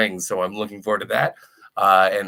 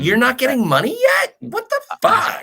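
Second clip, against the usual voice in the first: high, loud incredulous outburst; mock outrage; 13 semitones higher, 4 dB louder than usual. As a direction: squawk it in exaggerated disbelief.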